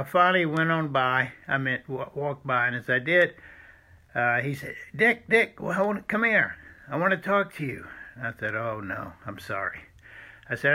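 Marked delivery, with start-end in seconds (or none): 5.0-7.9 calling out. Retold or imitated. Doctor calling him over. 8.6-9.8 soft, lower, embarrassed apology; apologising quietly to the doctor; sheepish apology. Retold or imitated. Retold apology, much softer.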